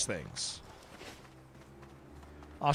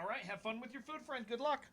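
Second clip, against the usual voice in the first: tender goodbye; 6 semitones higher, 15 dB quieter than usual. Real, not acted. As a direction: gently wish him well. Sending son off, tender goodbye.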